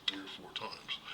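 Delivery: ordinary talk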